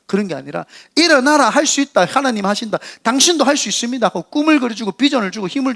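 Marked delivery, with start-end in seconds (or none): 0.9-4.1 higher-pitched rallying call; emphatic, can-do exhortation; rousing encouragement. Acted. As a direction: urge the downhearted to get up.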